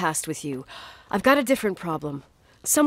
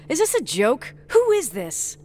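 high-pitched, rattled challenge; startled and indignant on the phone; alarmed demand; 6 semitones higher than usual. Acted. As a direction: demand to know who is calling, pitch jumping up. Demanding answers from anonymous caller.

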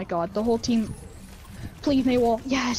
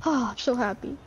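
usual speaking voice

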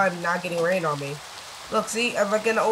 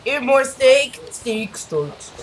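deep voice; 5 semitones higher, 7 dB louder, much slower than usual. Pretend. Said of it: "the deepest voice I can make him".

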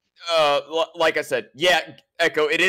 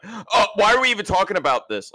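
higher, louder mocking whine of protest; mock complaint; 7 semitones higher, 4 dB louder than usual. Co-host mimicking how the other interrupts arguments, mock complaint. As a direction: imitate someone butting in to derail. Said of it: "you'll come in and be like".

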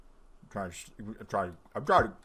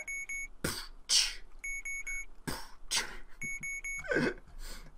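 voiceless mouth percussion; beatbox a sparse beat; 6 dB quieter than usual. Shown as a demonstration. Player making a beat to the beeps, beatboxing. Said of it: "Let me make a beat to that".

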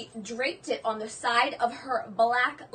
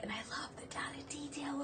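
soft whispered aside; 16 dB quieter than usual. Real, not acted. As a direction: lean in and whisper it.

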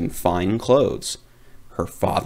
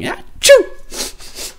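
high loud fake achoo; a big cartoon sneeze; squeaky witch sneeze; 6 dB louder than usual.

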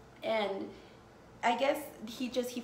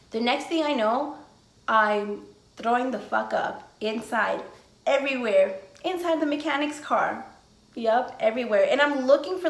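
loud excited retelling; 8 dB louder than usual. Real, not acted. Telling viewers about puking in the car, loud and excited. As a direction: tell it loud, like it's happening all over again.

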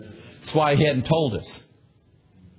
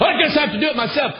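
fast, high, put-upon grumble; fed-up sigh of a quote; 13 semitones higher, 4 dB louder, faster than usual. Acted. Preacher mimicking a fed-up parent doing the chore himself, grumbling. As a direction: toss it off like a parent fed up with the kids.

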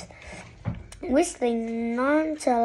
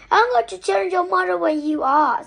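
high, loud tattling threat; indignant outburst, higher and louder throughout; angry threat; 6 semitones higher, 6 dB louder than usual. A narrator's reading. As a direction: raise your voice and threaten to tell. Margaret threatens to tell mother.

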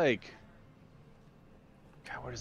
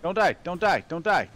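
shouting orders over battle; 6 semitones higher, 11 dB louder than usual. Real, not acted. Urgent plea mid-battle.

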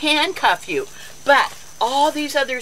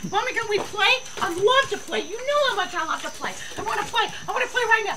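high eager pup voice; dog begging to play; 11 semitones higher, 4 dB quieter, faster than usual. Pretend. Voicing the dog begging mommy to play, high and eager. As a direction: whine to play like a puppy.

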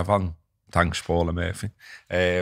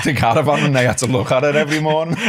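laughing; 7 semitones higher, 5 dB louder than usual. Real, not acted. Laughing while telling punchline.

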